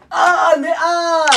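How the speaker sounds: high, loud drawn-out yell of pain; startled cry. Acted. Prisoner yelling as the flash hits him. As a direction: shriek as something hits your face.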